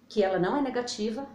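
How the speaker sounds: ordinary talk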